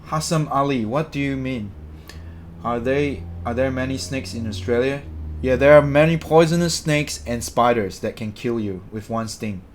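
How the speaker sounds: usual speaking voice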